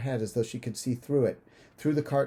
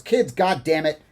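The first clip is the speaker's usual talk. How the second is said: loud, raised angry outburst; furious snarl; 5 semitones higher, 8 dB louder than usual. A narrator's reading. Father to his laughing kids in the car, furious snarl. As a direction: snap loudly, growly and furious. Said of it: "father snarls".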